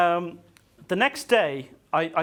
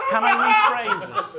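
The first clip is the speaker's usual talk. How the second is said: high, loud, laughing question; laughing high plea; 10 dB louder than usual. Retold or imitated. Guest asking interviewer, high and laughing. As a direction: beg to rephrase through laughter. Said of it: "She said".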